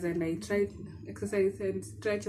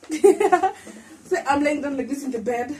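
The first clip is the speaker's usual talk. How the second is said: laughing through strain; 6 semitones higher, 8 dB louder, slower than usual. Real, not acted. Laughing through effort of moving.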